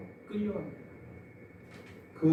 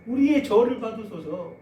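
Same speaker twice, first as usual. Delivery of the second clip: louder, jeering mock homage; bow in mockery while shouting; 5 semitones higher, 8 dB louder than usual. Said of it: "계속 침 뱉으면서 절규해요".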